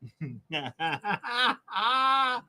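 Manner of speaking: high, shouted crying laugh. Real, not acted. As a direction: shriek with laughter. Reacting to cousin reveal.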